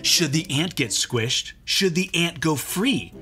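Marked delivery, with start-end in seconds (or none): none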